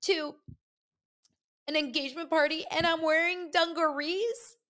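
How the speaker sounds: high, whiny imitation of a crying friend; mock tearful Danielle. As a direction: wail it like she's sobbing over her outfit. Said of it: "she's like".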